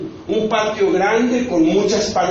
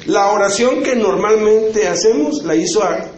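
normal talking voice

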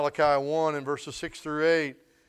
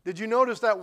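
ordinary talk

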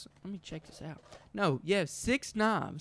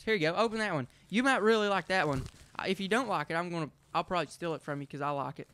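ordinary talk, nothing marked

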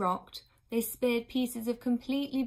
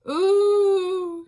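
high, loud drawn-out wail; a nurse's high keening cry; 10 semitones higher, 13 dB louder than usual. A narrator's reading. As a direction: let out a long mournful wail. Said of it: "there seemed to be not one wailing voice".

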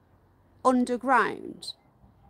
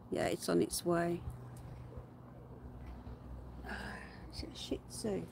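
whispered question, much softer; 6 semitones lower, 15 dB quieter than usual. Real, not acted. Quiet aside while filming.

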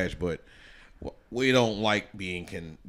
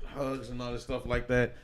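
ordinary talk

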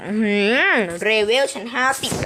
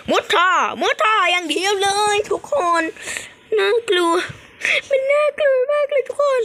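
much higher, excited scared voice; 11 semitones higher than usual. Real, not acted. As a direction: squeal in fright while fleeing the cat. Scared while escaping in game. (เสียงตกใจกลัวขณะหนีแมว)

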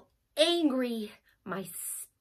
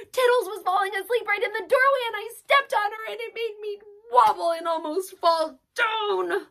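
upset rush; 8 semitones higher, 4 dB louder, faster than usual. Acted. Upset child telling what happened.

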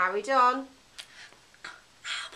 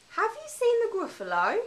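squeaky little mouse asking, much higher; 11 semitones higher than usual. Acted. Mouse asking the fox about the Gruffalo, high and squeaky. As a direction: lift it way up like a tiny mouse.

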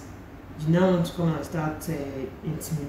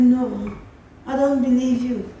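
higher, louder mock official voice; retold officer's objection; 7 semitones higher, 6 dB louder, slower than usual. Officer rejecting a traveler's story, mock indignant. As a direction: imitate an insistent official, pitched up and loud.

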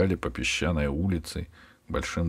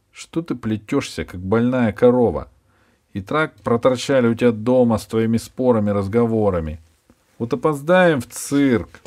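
put-on higher, louder girl scolding a dawdler; 4 semitones higher, 6 dB louder than usual. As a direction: nag him to hurry up. Girl scolds lagging boy.

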